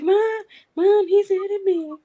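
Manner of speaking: high, loud mock wail; imitated kid-like squeal for help; screaming tattletale cat. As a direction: whine to mom as a bullied cat. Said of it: "screams like, like he's being killed".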